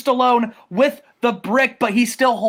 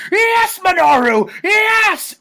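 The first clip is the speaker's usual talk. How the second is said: much higher, louder, slow drawn-out yes; cheering shout; 11 semitones higher, 5 dB louder, much slower than usual. Retold or imitated. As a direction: cheer like a manager at ringside. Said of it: "Paul Heyman is ringside going".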